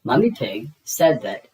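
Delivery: usual speaking voice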